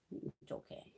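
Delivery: hushed reassuring whisper; calm the frightened kitten in a whisper. Real, not acted.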